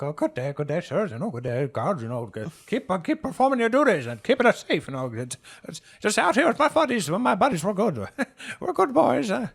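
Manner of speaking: high, overly cheerful greeting; fake-innocent old man. Old man faking innocence to guards, high cheery. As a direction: act innocent and chummy for the guards.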